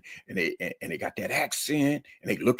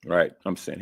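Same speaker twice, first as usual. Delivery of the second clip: ordinary delivery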